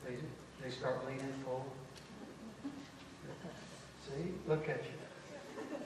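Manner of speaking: hushed demonstration for the congregation; lean-in quiet voice; talking quietly. Shown as a demonstration. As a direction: drop it very soft and low. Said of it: "if you talk quietly".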